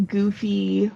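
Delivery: ordinary delivery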